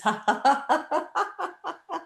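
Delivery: high laugh stretching out; long forced staccato giggle; laughter. Shown as a demonstration. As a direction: laugh through a pretend chore. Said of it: "with a sense of fun and laughter".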